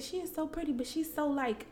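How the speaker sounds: put-on high, soft girly friend voice; imitating girly friend. Friend describing her at their first meeting, high and soft girly voice.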